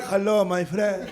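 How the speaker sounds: put-on slow, lightly accented hello; a hint of a Middle Eastern greeting; mock Middle Eastern accent. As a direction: put on a friendly hookah-lounge accent. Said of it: "now he's like".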